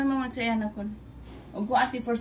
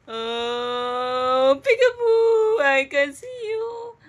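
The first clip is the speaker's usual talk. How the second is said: much higher, louder and slow; excited peekaboo; 3 semitones higher, 6 dB louder, much slower than usual. Real, not acted. Mother squeals peekaboo at her giggling baby.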